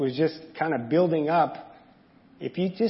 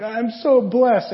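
high, slow, loud mock sob; mock tearful; 6 semitones higher, 7 dB louder, much slower than usual. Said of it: "we're just like".

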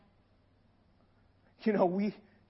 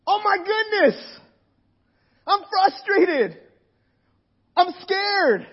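frustrated cry to God; 6 semitones higher, slower than usual. Retold or imitated. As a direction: cry out to God, exasperated and scared. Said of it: "We can feel like".

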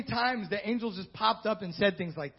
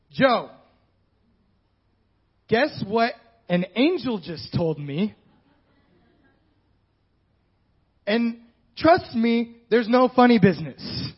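slow, louder, pleading reassurance; nervous confession; 6 dB louder, slower than usual. Pretend. Mary telling Joseph the news, slow and loud. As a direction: insist there's no funny business. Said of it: "and say".

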